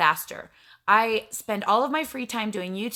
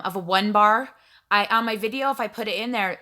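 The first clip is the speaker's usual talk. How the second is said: normal talking voice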